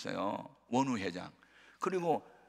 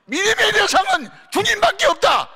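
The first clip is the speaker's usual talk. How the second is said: sudden full-voiced shout, much louder and higher; 16 dB louder than usual. Real, not acted. Preacher opening with a sudden shout. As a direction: bark the word out to wake the room.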